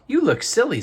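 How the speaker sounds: teasing armadillo. Acted. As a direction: poke fun at him brightly. Armadillo teases Porcupine.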